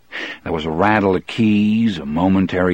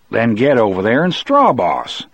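raised voice; 4 semitones higher, 4 dB louder than usual. Acted. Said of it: "raising my voice".

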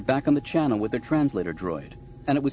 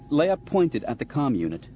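plain reading voice

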